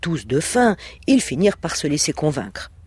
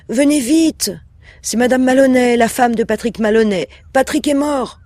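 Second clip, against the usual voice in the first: much higher and louder, panicked plea; frantic, weeping; 7 semitones higher, 5 dB louder than usual. Acted. Wife phoning the police, acting hysterical and tearful. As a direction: sell the panic to the police on the phone. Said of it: "elle dit en pleurant".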